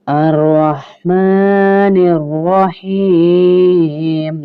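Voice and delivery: अर्रहमानिर्रहीम with both r sounds thick and full, drawn very slowly; अर्रहमानिर्रहीम chanted slowly with full ra; heavy ra recitation. Shown as a demonstration. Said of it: "दोनों रॉ मोटे होंगे, पुर पढ़ेंगे".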